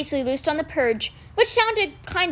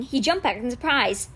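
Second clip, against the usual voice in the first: usual speaking voice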